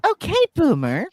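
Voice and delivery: high, loud sneering okay boomer; mocking okay boomer. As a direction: squeal it with a sneer. Card game catchphrase.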